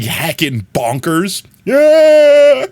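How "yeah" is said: high, loud squeal of glee; a bright high yelp of delight; excited squeal. Acted. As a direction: squeal it out like the tail is going wild. Excited tail-wiggle cheer to chat.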